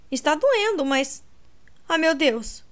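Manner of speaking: rising into alarmed pain; pained panic. A narrator's reading. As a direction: voice climbing as the pain hits. Kate sees her broken leg.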